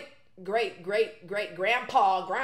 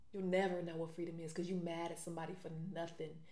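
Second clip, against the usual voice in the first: softer, dropped down, almost to herself; quiet repeat; 5 semitones lower, 11 dB quieter than usual. Real, not acted. Pitying Asa for never knowing freedom, said quietly. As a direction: let it sink, quietly.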